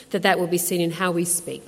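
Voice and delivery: ordinary delivery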